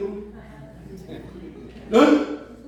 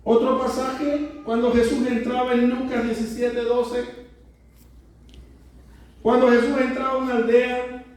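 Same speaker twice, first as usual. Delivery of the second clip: ordinary delivery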